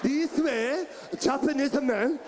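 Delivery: mock Japanese accent pushed from the diaphragm; hammed-up Japanese male accent; Japanese man. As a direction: imitate a forceful Japanese man. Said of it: "it come from diaphragm".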